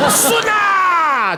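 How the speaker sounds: long, high cry of release; hammed-up shout as it all comes out; drawn-out high yell of comic relief; mock triumphant relief. Acts out relief after straining.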